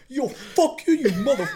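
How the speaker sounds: high mock curse at the other driver; hammed-up cussing, laughing; mock road rage. Angry driver yelling at the other driver, mock furious.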